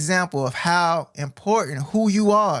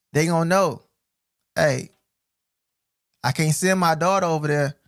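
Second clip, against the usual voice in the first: normal talking voice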